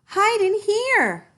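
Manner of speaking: very high, loud and slow; urgent call. A narrator's reading. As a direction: call the brothers inside fast. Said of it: "cried the third little pig".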